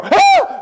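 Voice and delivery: piercing very high loud shriek; high loud scream of shock. A narrator's reading. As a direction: shriek at the blinding flash. Holy water flash.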